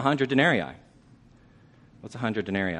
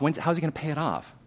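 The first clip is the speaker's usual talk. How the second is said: ordinary talk, nothing marked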